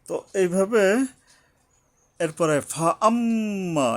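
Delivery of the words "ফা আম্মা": ফা আম্মা higher, with a long hummed m; gunnah. Shown as a demonstration. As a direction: hold the doubled mim in ফা আম্মা. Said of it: "এখানে আবার গুণনা করতে হবে". (ফা আম্মা-র মিমে গুন্নাহ করে পড়া)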